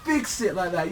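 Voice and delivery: high, loud, exasperated telling-off; hammed-up indignant plea; mock scolding. Acted scolding of Sharkin.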